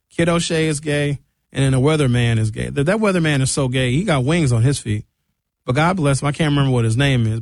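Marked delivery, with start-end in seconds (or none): none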